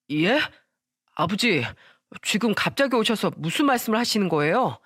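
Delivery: usual speaking voice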